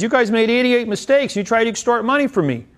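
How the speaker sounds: high, loud, indignant protest; indignant client. Retold or imitated. Client protesting to the judge, high and indignant. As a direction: squeal it like an outraged defendant.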